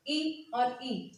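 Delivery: ई short then ई long, very slowly; slow vowel contrast. Shown as a demonstration. Teacher slowly sounding short and long ई for students. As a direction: stretch the second ई well past the first. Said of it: "आपने सबसे ज़्यादा जोर किस पे दिया".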